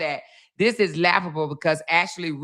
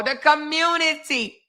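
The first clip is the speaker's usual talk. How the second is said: slow, higher sarcastic echo; sarcastic repeat, drawn out and higher; mocking echo; 6 semitones higher, slower than usual. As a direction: parrot her word back with scorn. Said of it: "here we go again".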